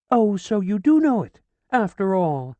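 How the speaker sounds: high, loud sharp retort; triumphant scolding. A narrator's reading. Widow scolding Ferdinand after the clout, loud. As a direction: snap it back at him.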